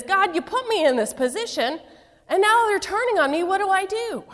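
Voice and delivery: exasperated cry climbing high; frustrated pastor. Retold or imitated. Frustrated pastor complaining to God, pitch climbing high. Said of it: "feels so frustrated and says".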